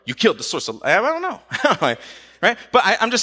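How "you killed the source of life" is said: hushed, low-pitched accusation; alternative reading. Acted. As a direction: say the charge low and understated.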